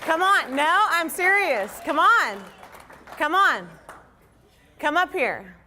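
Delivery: high, loud pleading calls; yelling across the room. Real, not acted. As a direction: beg them loudly to come up. Begging teammates to come up.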